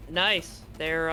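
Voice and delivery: usual speaking voice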